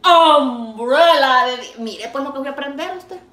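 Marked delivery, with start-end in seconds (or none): 0.0-1.4 "Umbrella" very loud and drawn out; drilled English word. Retold or imitated. Learner made to get the word out, "Umbrella" loud and stretched. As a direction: stretch "Umbrella" slowly, at full volume. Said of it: "Para que me saliera la palabra así".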